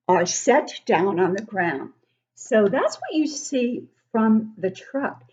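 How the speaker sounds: ordinary delivery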